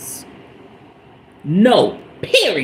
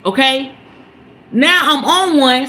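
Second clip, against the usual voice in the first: ordinary delivery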